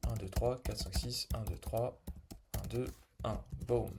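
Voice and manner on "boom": normal talking voice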